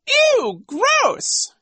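loud, very high squeal; squeamish woman. A narrator's reading. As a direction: squeal in disgust. Mrs. Bass is revolted.

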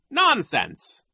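high, scornful dismissal; dismissive vice principal. A narrator's reading. Vice principal rejects the children's claim, dismissive. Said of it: "Nero said".

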